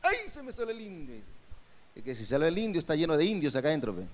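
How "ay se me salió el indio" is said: higher, hammed-up voice of someone excusing a slip; mock the excuse in a silly put-on voice; mock careless excuse. Repeats quote after laughter.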